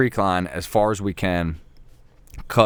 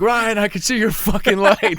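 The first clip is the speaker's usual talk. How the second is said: high, louder yell back; retold shout; 12 semitones higher, 4 dB louder than usual. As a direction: holler it over the pouring rain.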